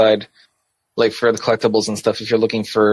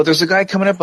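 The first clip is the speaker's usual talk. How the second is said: warning callout; 8 semitones higher, faster than usual. Retold or imitated. Acting out companion's in-game warning.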